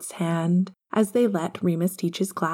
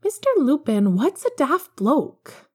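curious schoolgirl; 8 semitones higher than usual. Acted. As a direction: pitch it up like a curious pupil. Young schoolgirl asks teacher question.

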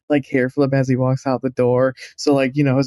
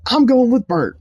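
high, mock-grand announcement; defiant declaration; 6 semitones higher than usual. Earl stands up and defies Mister Nelson, mock-grand. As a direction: stand and proclaim it with a flourish. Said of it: "stand up and be like".

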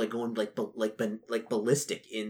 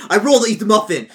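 louder, higher, insistent; loud eager demand; 8 semitones higher, 13 dB louder than usual. Retold or imitated. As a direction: announce the roll loudly. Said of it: "Be like".

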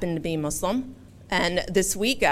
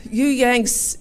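put-on English accent; 6 semitones higher, 4 dB louder than usual. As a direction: repeat it in a gentler English accent. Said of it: "forgive my English accent".

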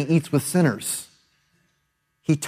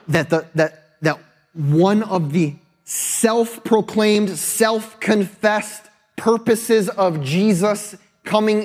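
higher, slower, swelling toward a climax; building preaching; 6 semitones higher than usual. Acted. Preacher tells the congregation Jesus' mission, building fervour. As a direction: lift the congregation as you preach. Preacher rises to full force.

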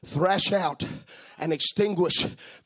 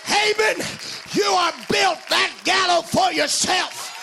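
preaching; 10 semitones higher than usual. Acted. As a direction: preach it out, full force. Preaching at full force, curse.

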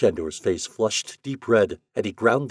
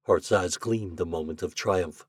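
ordinary delivery

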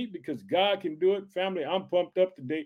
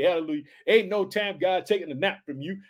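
ordinary talk

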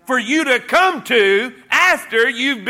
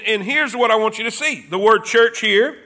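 normal talking voice

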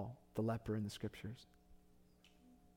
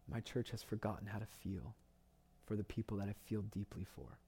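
hushed grieving; 6 dB quieter than usual. Retold or imitated. Voicing God's grieving heart.

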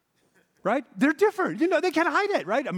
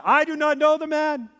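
higher, insistent denial; bold-faced lie; 7 semitones higher, 4 dB louder than usual. Retold or imitated. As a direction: blurt the lie out boldly. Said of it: "spews out a bold-faced lie".

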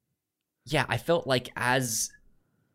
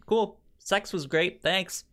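offhand; 9 semitones higher than usual. Retold or imitated. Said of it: "you're more just like".

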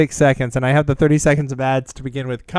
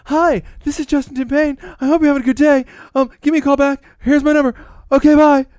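much higher chirpy phone message voice; Dan doing Justin; 12 semitones higher, 4 dB louder than usual. Retold or imitated. Imitating Dan who imitated Justin on voicemail. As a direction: leave a cheery voicemail as a parody of Justin. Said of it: "I can do him doing imitation of me".

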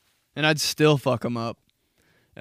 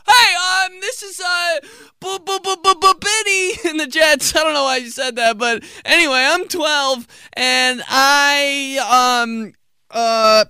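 put-on much higher, louder stammering kid; panicked kid voice; 14 semitones higher, 11 dB louder than usual. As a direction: blurt it in a panic.

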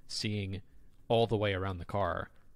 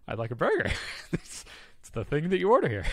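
laughing customer; 6 semitones higher, 5 dB louder than usual. Retold or imitated.

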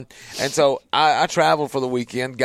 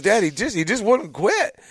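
put-on high pleading child; a touch of a little boy; kid's voice; 9 semitones higher than usual. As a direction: plead like a young son. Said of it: "He goes".

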